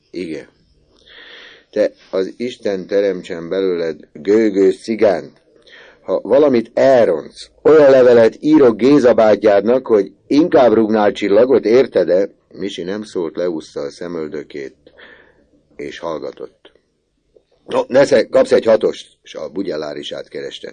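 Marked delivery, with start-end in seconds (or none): 1.7-12.3 put-on louder, higher gruff uncle warning the boy; threatening uncle. Uncle warns Misi not to mess up, loud threatening voice. 17.6-19.2 hearty uncle. Acted. Uncle offers Misi a coin.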